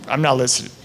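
ordinary talk

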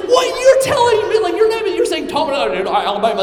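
high, loud, mock-outraged disbelief; incredulous outburst. Preacher retells reacting to friend's accent remark, incredulous. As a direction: shout it back in hammed-up disbelief. Acted argument over accents.

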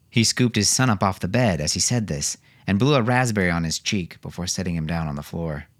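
ordinary delivery, nothing marked

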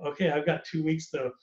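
usual speaking voice

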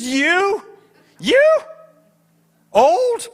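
high, loud, hooting disbelief; laughing like crazy. Retold or imitated. Angel mocking the old pastor, hilarious disbelief. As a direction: burst out incredulous, word by word. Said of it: "The angel was just laughing like crazy".

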